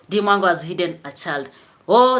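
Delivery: ordinary delivery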